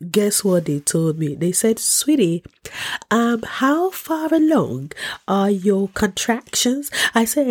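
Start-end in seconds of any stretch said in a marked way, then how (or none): 1.8-6.9 high, slow, sugary phone receptionist; a sweet nurse talking down to a patient; syrupy nurse voice. Retold or imitated. Hospital receptionist to woman in labor, slow sugary voice.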